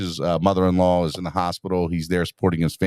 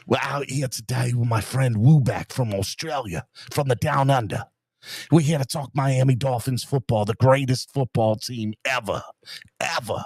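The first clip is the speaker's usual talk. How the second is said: hammed-up Aussie accent in a lightly put-on voice; Australian accent; 2 semitones higher than usual. Host playing an Aussie for a viewer in Australia, mock Aussie accent. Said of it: "that's my Australian accent".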